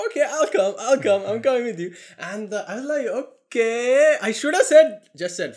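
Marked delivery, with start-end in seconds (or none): 0.1-1.9 eager insistence. Retold or imitated. Insistent stranger quoted. 3.1-4.2 high hesitant okay. Retold or imitated. Reluctant agreement quoted.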